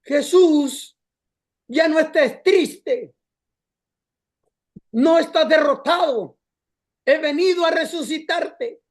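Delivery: retold triumphant shout, drawn out; Holy Spirit shouting. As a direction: thunder out a rescue to someone in a dark corner. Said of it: "grita y dice".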